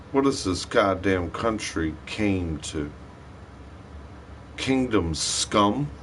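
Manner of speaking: normal talking voice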